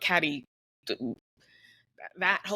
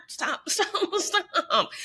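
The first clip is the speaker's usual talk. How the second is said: laughing plea; 10 semitones higher, much slower than usual. Real, not acted. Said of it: "begging while laughing".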